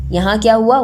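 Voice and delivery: normal talking voice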